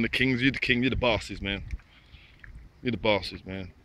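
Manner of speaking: usual speaking voice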